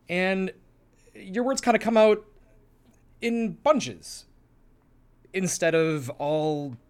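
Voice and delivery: high, halting bursts of bunched-up words; William Shatner. Shown as a demonstration. Co-host demonstrates fragmented cadence, cliché impression. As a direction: fight to get the words out, stumbling between pauses. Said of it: "trying to do a very cliche William Shatner".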